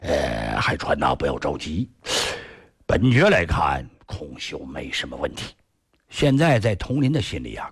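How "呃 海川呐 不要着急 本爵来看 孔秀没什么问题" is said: low, measured noble's voice; reassuring prince. Acted. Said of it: "雍亲王这时候啊，安慰他". (雍亲王低沉地安慰佟林)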